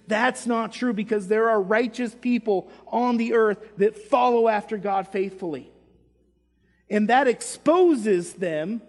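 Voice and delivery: loud, forceful sermon delivery; emphatic preaching. Real, not acted. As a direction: drive each point home to the congregation.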